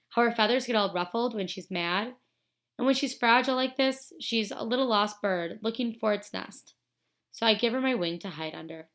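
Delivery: usual speaking voice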